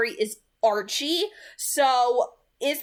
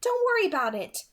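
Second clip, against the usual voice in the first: higher, clipped voice for Alice; Alice, curt; 9 semitones higher than usual. A narrator's reading. Alice brushing off concern, curt and higher. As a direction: cut the worry short as Alice. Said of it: "Alice said curtly".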